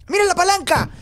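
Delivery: high, excited calling out; excited cry. Real, not acted. Player spots the lever, excited high cry. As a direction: cry out at a sudden find.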